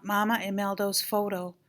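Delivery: ordinary delivery, nothing marked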